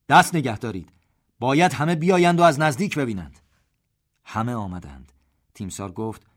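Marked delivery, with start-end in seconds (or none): 0.1-3.4 loud, higher cry to halt the drill; shouted order. A narrator's reading. Delighted general shouts to halt the drill. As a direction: shout across the field to stop them. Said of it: "داد زد".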